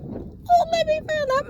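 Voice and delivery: very high, bright squeal of delight; excited squeal. Retold or imitated. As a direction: squeal it like a thrilled grandma. Mom's excited reply to son.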